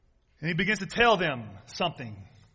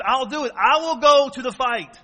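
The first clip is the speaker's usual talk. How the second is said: put-on young David; 5 semitones higher, 8 dB louder than usual. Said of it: "he's telling him, he says".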